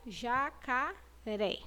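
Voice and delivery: JACARÉ slow and soft, syllables apart, open É; pauses between the syllables of Jacaré; open-mouthed vowel. Shown as a demonstration. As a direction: sound out Ja-ca-ré with a wide-open mouth. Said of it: "eu abro a minha boca".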